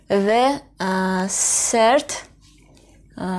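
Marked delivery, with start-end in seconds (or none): none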